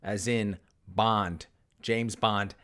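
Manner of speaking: slow, measured Bond, James Bond; a beat between Bond and James Bond, both slow; Bond cadence. Shown as a demonstration. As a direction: deliver Bond, James Bond like the film line. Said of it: "same cadence".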